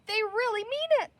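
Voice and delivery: frightened. Acted. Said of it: "cried Penny".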